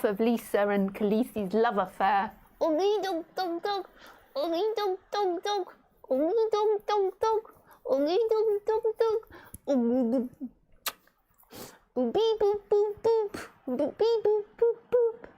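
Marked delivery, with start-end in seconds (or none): 2.6-10.3 high sing-song oogie dog; sing-song pet babble. Real, not acted. Sing-song noises to bird. 11.9-15.3 squeaky playful boops; high boop beep noises. Real, not acted. Beeping sounds to bird.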